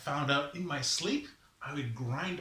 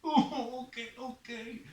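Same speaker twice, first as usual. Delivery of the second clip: tearful agreement; 11 semitones higher than usual. Acted. Sufferer waits as Raven leaves, high tearful whimper. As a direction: give in with a small put-on sob. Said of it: "Don't cry".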